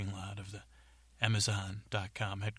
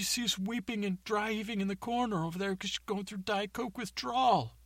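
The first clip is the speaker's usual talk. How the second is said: high, whiny rant; ranting fired worker; 12 semitones higher than usual. Acted. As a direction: complain about soda, pitched up. Worker rants about soda and firing.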